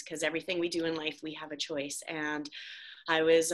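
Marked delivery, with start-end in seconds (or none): none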